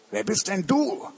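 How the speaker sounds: a burglar, relieved, peeks at the cage. Acted. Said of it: "er sagt so". (viel höhere, überraschte Einbrecherstimme)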